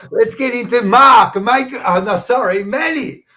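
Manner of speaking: high, loud call-up with a flustered correction; excited host announcement. Real, not acted. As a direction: announce the next act loudly, tripping over the name. Host calls on next comic, fumbles name.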